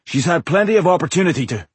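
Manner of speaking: lightly voiced urgent speaker; urgent, pressing character voice. Character dialogue.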